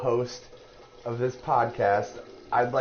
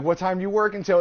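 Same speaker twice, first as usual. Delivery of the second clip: higher, louder incredulous ask; exasperated question; 8 semitones higher, 4 dB louder than usual. Retold or imitated. Host phoning Mike at work, incredulous. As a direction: demand it in disbelief. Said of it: "I'm like".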